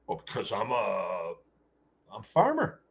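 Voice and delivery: mock farmer voice, rising on farmer. Mock farmer answers why.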